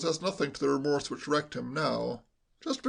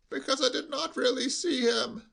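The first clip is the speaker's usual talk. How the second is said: put-on an old servant bleating every word; bleating old butler; 9 semitones higher than usual. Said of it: "bleated like a sheep".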